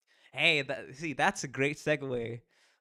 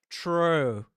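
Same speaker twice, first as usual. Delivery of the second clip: loud agreement; 5 semitones higher, 9 dB louder than usual. Real, not acted. Player agrees loudly.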